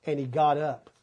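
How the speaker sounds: ordinary delivery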